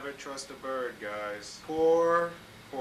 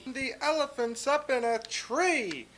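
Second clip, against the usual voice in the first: higher, gleeful taunt; gleeful shout; 11 semitones higher, 6 dB louder than usual. Acted. Friends jeering at Horton up the tree, gleeful. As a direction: crow it out like mocking friends. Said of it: "they shouted with glee".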